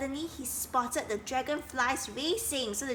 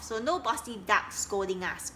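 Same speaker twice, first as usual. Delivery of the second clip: ordinary delivery, nothing marked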